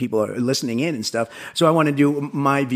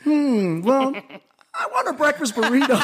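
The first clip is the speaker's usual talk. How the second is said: high-pitched mock-innocent order, breaking into laughter; much higher, put-on pondering voice; teasing customer; 12 semitones higher, slower than usual. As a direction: play the customer winding up the cook. Acting out Frank provoking the cook.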